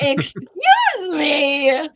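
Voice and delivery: long drawn-out scandalized retort; playfully appalled, very slow; mock shock. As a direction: stretch it out like an affronted adult. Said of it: "I was like".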